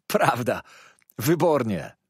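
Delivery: acted enthusiastic shout; calling out. A narrator's reading. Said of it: "zawołał Ham".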